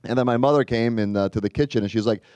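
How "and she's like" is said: ordinary talk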